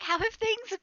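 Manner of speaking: high, loud and drawn out; effusive greeting. Acted. Zaleria hugging a friend, effusive greeting. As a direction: stretch it into a big, gushing hello. Character hugs and greets Big Sally.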